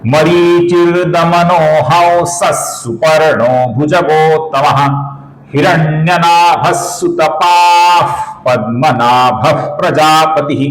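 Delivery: slow chant, half-verses flowing unbroken; continuous reading. Shown as a demonstration. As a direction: recite the whole verse in two smooth runs. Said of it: "फिर से एक बार देखिए".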